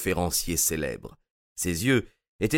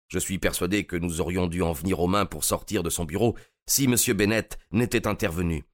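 ordinary delivery

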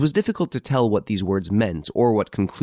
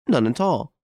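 put-on old lady's raised, firm little answer; 5 semitones higher than usual. A narrator's reading. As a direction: answer high, as the old lady. Old lady answers on telephone.

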